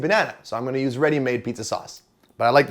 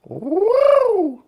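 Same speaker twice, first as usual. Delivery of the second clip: excited high woo; 4 dB louder than usual. Real, not acted. Excited squeal.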